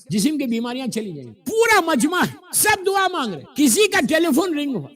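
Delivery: high, forceful sermon delivery; preaching. Acted. Preaches at raised pitch. (پرجوش خطیبانہ انداز)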